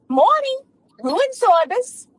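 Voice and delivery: bright, high phone greeting; cheery receptionist. Acted. Room service lady greeting a guest on the phone, high and cheery. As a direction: answer the phone sweetly.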